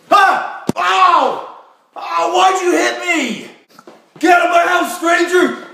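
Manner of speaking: high, loud panicked yelling; yelling in pain. Acted. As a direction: shout in panic at the attacker. Slapstick fight, yelling.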